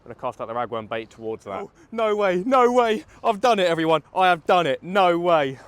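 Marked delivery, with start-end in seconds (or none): 1.6-5.6 loud, high, thrilled shouting; overjoyed angler shouting to viewers; real excitement. Real, not acted. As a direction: yell it out over a big catch. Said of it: "I'm shaking".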